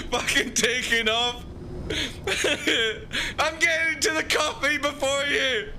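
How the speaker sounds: high giddy gloating; gloating show-off. Pretend. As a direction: crow about beating everyone. Acting gloating flying-car driver.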